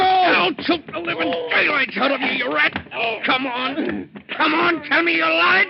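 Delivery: high, loud, slow menacing shout; furious threat. Acted. Danny to Joe, demanding the truth, furious slow shouting threat. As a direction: force the confession out of him.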